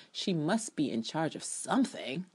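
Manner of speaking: normal talking voice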